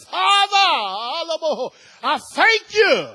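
loud, high cry of thanks to God; joyful praise shout. Retold or imitated. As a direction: lift it up, praising Father. Grateful prayer after work.

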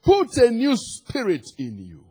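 high, emphatic scripture proclamation easing at the end; full-force preaching. Real, not acted. Preacher declaring God's gift of a new heart, forceful preaching. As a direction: proclaim it with weight on each phrase.

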